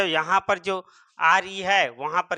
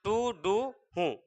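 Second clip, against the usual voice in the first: slow, careful drill of each syllable; slow spelled-out sounds; 4 dB quieter, slower than usual. Shown as a demonstration. Teacher sounds out tu du hun slowly for students. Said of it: "याद रखना".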